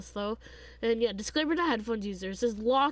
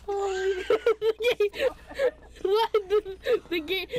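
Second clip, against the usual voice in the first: laughing; 7 semitones higher, 3 dB louder than usual. Real, not acted. Laughing at the joke.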